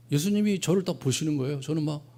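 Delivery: normal talking voice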